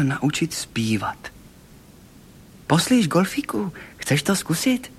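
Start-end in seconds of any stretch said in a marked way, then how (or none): 2.7-4.9 higher-pitched, inviting question; children asking eagerly. A narrator's reading. Children inviting Golfík to try singing, high eager voice.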